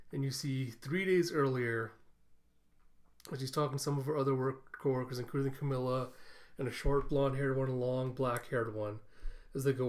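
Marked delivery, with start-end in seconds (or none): none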